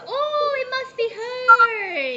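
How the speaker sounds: high, drawn-out mock discovery; slow, high-pitched surprise; delighted realization. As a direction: act out a big "aha" of recognition. Acts out recognising the student online.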